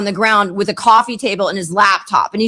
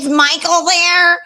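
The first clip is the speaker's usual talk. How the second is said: put-on high, drawn-out voice of a girl caller; imitated high-voiced caller asking; caller impression; 6 semitones higher, slower than usual. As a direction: mimic her, pitched up and slow. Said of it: "And she goes".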